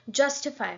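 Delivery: "justify" with a burst of air on its first syllable; stress on first syllable. Shown as a demonstration. Teacher showing where the stress falls, "justify" hit on the first syllable. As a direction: punch the start of "justify". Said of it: "stress कहाँ पे एक साथ में air burst".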